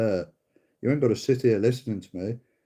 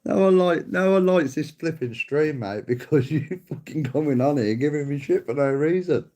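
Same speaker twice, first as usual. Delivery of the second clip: higher and louder, laughing at the troll; loud exasperated outburst; 6 semitones higher, 4 dB louder than usual. Real, not acted. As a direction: blast back, half laughing.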